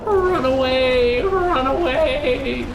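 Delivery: high, slow wailing run away; mock panic wail. Gamer fleeing belching boss, high slow mock wail.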